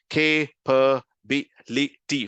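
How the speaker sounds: "K, P, B, L, T" as kay, puh, bi, li, tee; broken into syllables. Shown as a demonstration. Teacher breaking capability down, "K, P, B, L, T" syllable by syllable. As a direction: separate each chunk of "K, P, B, L, T" distinctly. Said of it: "break it down into different chunks".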